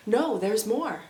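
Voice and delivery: ordinary talk, nothing marked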